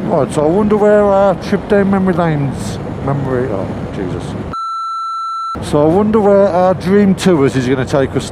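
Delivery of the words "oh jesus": usual speaking voice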